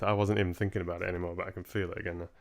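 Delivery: plain reading voice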